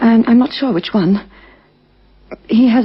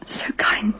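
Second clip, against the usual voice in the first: much softer, whispered through tears; grieving whisper; 7 dB quieter than usual. Acted. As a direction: whisper it as the grief takes over. Widow breaks down over husband.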